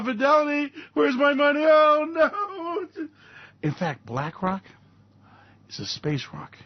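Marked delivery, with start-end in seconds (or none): 0.0-3.1 high, whining mock alarm; a retiree suddenly panicking; mock panic. Panicked investor calling Fidelity.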